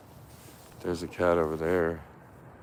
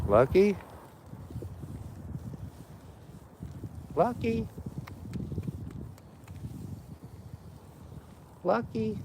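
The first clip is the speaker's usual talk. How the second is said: much higher sing-song call; calling the cat; 4 dB quieter than usual. Real, not acted. Searching the street for Lucky, high calling voice.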